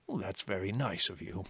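soft, indulgent elder; polite old chief. A narrator's reading. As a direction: humour him gently in an old rabbit's voice. Old chief replies to Fiver.

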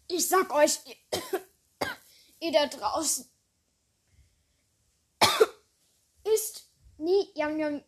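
warning broken by coughs; coughing from spicy food. Real, not acted. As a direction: push the words out between coughs. Coughing from chili.

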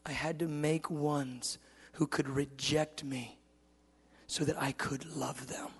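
soft, slow, deliberate; God declaring love. Retold or imitated. God explaining love to his son, soft and slow. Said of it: "He said".

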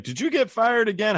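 mock mad mother. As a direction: play his mother telling him off. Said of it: "Was she mad?".

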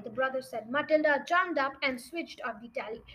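ordinary delivery

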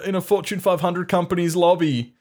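put-on high excited kid fantasizing. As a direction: gush like a greedy kid.

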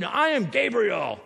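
slow, booming angelic proclamation; Gabriel. Acted. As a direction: announce yourself like an affronted mighty angel. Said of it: "The angel said".

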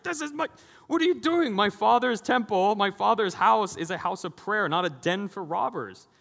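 high, loud righteous outburst; angry Jesus. Retold or imitated. Jesus scolding temple sellers, loud high anger. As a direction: make Jesus roar at the money changers. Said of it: "this is the angry Jesus".

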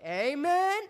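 high, rising mock congregation amen; hammed-up call-back lifting at the end; crowd responding. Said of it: "And they all say".

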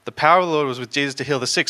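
fast run-through; quick recap. Shown as a demonstration. Repeating the verse to the congregation, fast.

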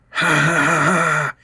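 high, slow cackling laugh; crackling laugh. Acted. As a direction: cackle with glee. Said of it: "he crackled".

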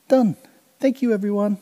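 cheeky victory call; triumphant thanks. Acted. Kancil thanking the crocodiles from the far bank, high and gleeful.

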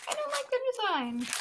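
high squeaky complaining voice; whiny complaint. Retold or imitated. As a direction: whine it high and fussy. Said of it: "I was like".

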